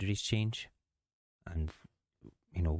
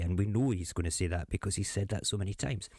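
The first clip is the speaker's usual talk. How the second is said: ordinary talk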